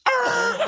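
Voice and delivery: shrill panicked yelp; high panic scream. Retold or imitated. Teen flooring it away, shrill panicked yelp. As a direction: yelp in panic while fleeing.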